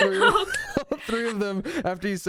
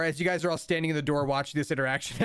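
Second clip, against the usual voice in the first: ordinary talk, nothing marked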